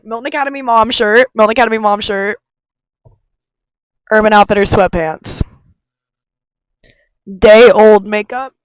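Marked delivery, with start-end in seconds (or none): none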